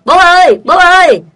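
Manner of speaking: loud high call for dad; shouting. Acted. Boy running home shouting to his father, loud high call. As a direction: yell out to dad in alarm. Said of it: "chạy về hét to".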